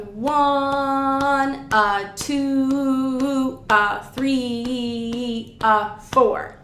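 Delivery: very slow, drawn-out dotted rhythm counting. Shown as a demonstration. Oboe teacher demonstrating measure five's rhythm, slowed right down. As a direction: stretch each number long, then a short "a". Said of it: "that slowed it down a lot".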